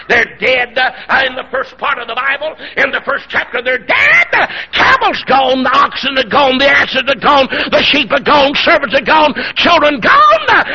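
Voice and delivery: rhythmic list climbing to high, stretched shouts; full-force preaching. Real, not acted. Preacher recounting Job's losses to congregation, full-force preaching.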